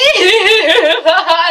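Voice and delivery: high loud laughing fit; real burst of laughter. Real, not acted. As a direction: crack up for real. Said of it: "laughing while recapping".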